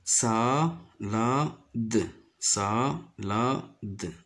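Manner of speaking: salade split into sa... la... de; slow, separated sa - la - de; syllable by syllable. Shown as a demonstration. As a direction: sound out salade with a gap after each syllable. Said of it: "J'entoure cette syllabe".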